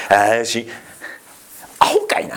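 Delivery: playful Kansai-dialect jab, half whispered; gentle Kansai-style teasing; light Kansai dialect. Retold or imitated. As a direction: tease in Kansai dialect so it doesn't sting. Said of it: "関西弁っぽく言った方が".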